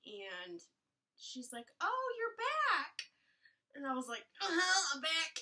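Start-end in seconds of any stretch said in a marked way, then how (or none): 1.8-2.9 high, slow drawn-out nurse greeting; mock sing-song nurse. Nurse greeting the returning patient, high and drawn out. 4.4-5.3 tearful. Retold or imitated. Laboring mom answering nurse.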